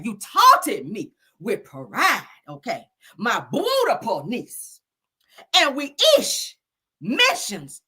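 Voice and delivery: slow, drawn-out poet delivery; mock-solemn spoken word; dramatic poem reading. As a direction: lean hard into every line.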